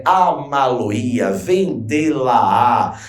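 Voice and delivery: Amaloia and vendê-la-á said very slowly, piece by piece; slow teaching demo; slow syllable demo. Shown as a demonstration. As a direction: lay out each verb part slowly. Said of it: "Então, perceba, são termos oxítonos".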